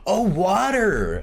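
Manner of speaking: high, slow, loud drawn-out American "water" with a flapped t; exaggerated American waiter. American waiter suddenly getting the Australian order, hammed-up high slow "water". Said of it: "it's definitely the accent".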